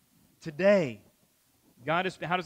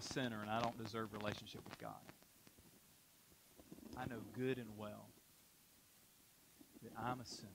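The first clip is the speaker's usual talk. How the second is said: quiet confession; 7 semitones lower, 15 dB quieter, faster than usual. Real, not acted. Pastor confessing his own sin.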